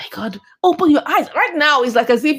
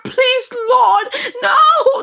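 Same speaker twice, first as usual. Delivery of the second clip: alarmed pleading; 9 semitones higher than usual. Acted. Storyteller begging that she not be kidnapped, high alarmed wail. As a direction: cry out a desperate prayer, pitched way up. Pleading as car nears lost woman.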